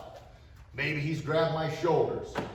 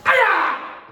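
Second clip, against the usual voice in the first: piercing high shouted hi-ya; full-force martial arts yell; lion voice kiai; 12 dB louder than usual. Shown as a demonstration. Instructor showing kids his lion voice, full kiai. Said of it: "I got my tiger eyes and lion voice".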